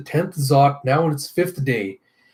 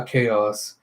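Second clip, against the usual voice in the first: ordinary delivery, nothing marked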